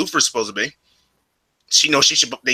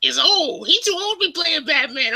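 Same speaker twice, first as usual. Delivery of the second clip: high mom impression. Son imitating his mom's gripe about Affleck, high and squeaky. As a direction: stretch it out in a high mocking falsetto. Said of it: "She's like".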